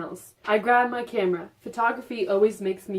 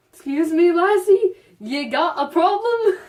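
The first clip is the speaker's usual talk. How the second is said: raised, louder hammed-up fury with a laugh; mock angry dad; 9 semitones higher, 8 dB louder than usual. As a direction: play the drunk dad snapping. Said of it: "His voice raised in anger".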